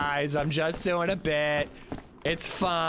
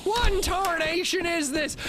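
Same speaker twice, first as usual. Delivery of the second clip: higher shouted hillbilly exclamation; 12 semitones higher than usual. Acted. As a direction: yell it like a startled hillbilly. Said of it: "the most hillbilly I can do".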